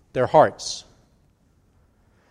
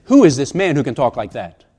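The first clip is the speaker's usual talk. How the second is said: indignant challenge in one run; disapproving, pointed rhetorical question; 2 semitones lower, 4 dB louder than usual. Shown as a demonstration. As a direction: demand it as an accusation, expecting no answer. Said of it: "meant it as a rhetorical question".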